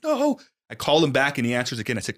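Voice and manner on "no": high, drawn-out cry of protest; retold desperate no. Caller after brother hangs up, high desperate protest. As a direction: wail it high in mock despair. Brother hung up on his plea.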